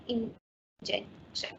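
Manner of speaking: drawn-out whisper of injection, very slow; whispered word. Shown as a demonstration. Teacher to students finishing the example, slow whisper. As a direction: breathe the word injection out slowly, no voice.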